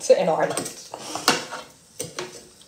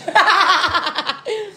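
high giggling laugh; burst of laughter; 9 semitones higher than usual. Real, not acted. Laughs at own Drogheda remark.

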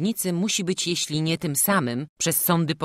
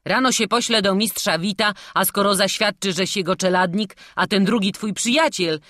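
higher, louder guard reasoning; explaining; 5 semitones higher, 5 dB louder than usual. A narrator's reading. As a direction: explain it firmly to the boy. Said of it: "tłumaczył mu strażnik". (tłumaczenie z naciskiem)

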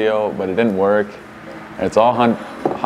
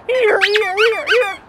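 calling out; much slower than usual. Retold or imitated. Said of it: "I'm like".

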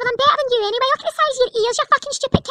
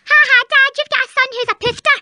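ordinary talk

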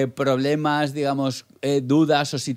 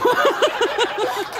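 very high burst of laughter. Real, not acted. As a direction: squeal with laughter. (risa muy aguda)

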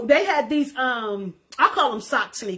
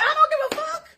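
high-pitched, carefree I-don't-care cry; defiant outburst; 13 semitones higher, 3 dB louder than usual. Retold or imitated. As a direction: throw it off like nothing can touch you. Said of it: "I said".

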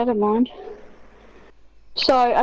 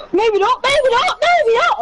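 each maybe not higher and louder; building hype; 11 semitones higher, 5 dB louder than usual. Real, not acted. Gamer winning a close fight, building to a hyped shout. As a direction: build the excitement with every repeat. Fight turns in his favour.

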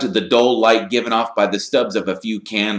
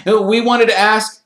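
hasty, high, loud start; quick; 6 dB louder than usual. A narrator's reading. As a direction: jump in quickly to explain. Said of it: "said Harry quickly".

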